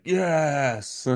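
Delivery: quick high excited cheer; excited yes. Retold or imitated. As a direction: cheer it like a thrilled fan. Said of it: "and was like".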